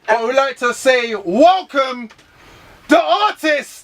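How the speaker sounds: slow, building ceremonial announcement; announcer introduction. Real, not acted. Host welcomes the artist, grand slow announcing. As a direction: draw it out like a ring announcer.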